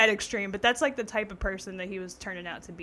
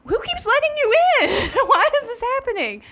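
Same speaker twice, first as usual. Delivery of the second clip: high, loud, laughing disbelief; cracking up in disbelief; 13 semitones higher, 8 dB louder than usual. Retold or imitated. To herself about ex who keeps showing up, high laughing disbelief. Incredulous at ex sitting on couch.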